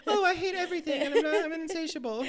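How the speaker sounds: very high, loud, breaking into laughter; laughing mock voice. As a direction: try a silly voice while cracking up. Mocking a man's voice while laughing.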